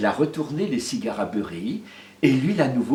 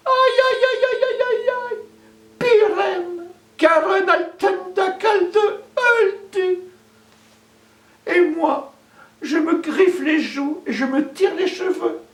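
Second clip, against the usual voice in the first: high, loud sung wail of grief; keening lament; 3 dB louder than usual. Acted. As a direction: wail for the dead husband. Widow answers the crow, grieving.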